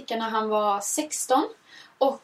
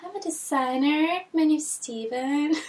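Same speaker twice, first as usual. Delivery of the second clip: slow, higher, shy English voice; shy designer; 5 semitones higher, slower than usual. Retold or imitated. The shy designer introduces himself, slow and higher. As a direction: introduce yourself bashfully and slowly. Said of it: "var väldigt så, väldigt blyg".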